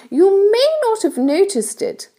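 high-pitched storybook ladybird voice; kindly ladybird. A narrator's reading. Ladybird explains things to James, kindly high voice. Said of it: "the ladybird went on".

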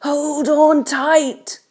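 high, drawn-out cry of excitement; excited call. A narrator's reading. Old grasshopper warns everyone, excited call. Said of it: "shouted the old green grasshopper".

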